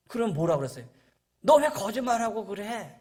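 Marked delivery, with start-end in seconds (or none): 1.4-3.0 put-on a raised, higher scolding of a lying child; mock scolding. Father scolding his son for lying, raised scolding voice. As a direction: scold the boy sharply, voice up. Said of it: "그렇게 야단쳐야 되잖아요".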